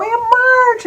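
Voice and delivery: high-pitched happy call, drawn out; delighted greeting. Retold or imitated. Niece greeting her aunt in a dream, high delighted cry.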